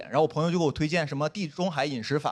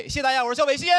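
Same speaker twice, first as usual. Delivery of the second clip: sign-off shout; 7 semitones higher, faster than usual. Real, not acted. Closing the set to audience.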